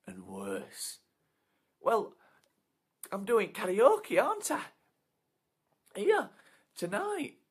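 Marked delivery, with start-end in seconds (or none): 1.8-7.4 high, loud, eager; relieved karaoke bloke. Acted. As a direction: gush with relief. Bloke explaining.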